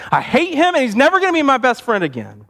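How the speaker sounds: high-pitched, indignant outburst; acted kid anger. Boy telling Mom about his friend, mock anger. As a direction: declare it like a hurt, angry boy. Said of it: "the tears turned to anger".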